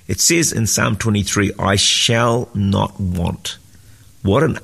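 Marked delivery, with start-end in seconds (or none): 1.6-3.6 "I shall not want" very slowly, word by word; slow and deliberate. Shown as a demonstration. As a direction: let each word of "I shall not want" stand alone, drawn out. Savouring the psalm verse slowly.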